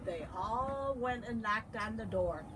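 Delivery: plain reading voice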